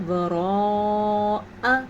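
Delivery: وراء stretched slowly, long madd held; drawn-out recitation. Shown as a demonstration. Teacher showing the long madd in وراء. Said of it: "اتنا سا".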